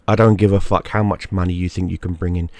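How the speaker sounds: ordinary talk, nothing marked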